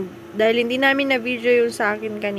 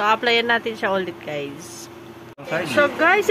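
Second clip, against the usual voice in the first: ordinary talk, nothing marked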